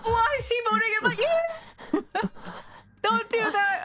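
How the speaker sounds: real fright, crying out at a jump scare; frightened cry. Real, not acted. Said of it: "Didn't mean to react".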